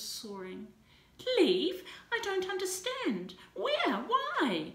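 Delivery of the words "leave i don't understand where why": high, loud stammering alarm; stammered. A narrator's reading. Mother learning her son is being taken, high stammering panic. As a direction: stumble over each word in shock. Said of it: "she stammered".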